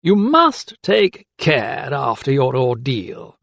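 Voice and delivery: slow, knowing mock concern; knowing smile. A narrator's reading. Magistrate to doctor after ordeal, knowing. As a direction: draw it out through a smile. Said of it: "his lips curling in a knowing smile".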